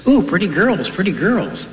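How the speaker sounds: high, swooping parrot squawk-talk. Acted. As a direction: coo it like an admiring pet parrot. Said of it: "The parrot says".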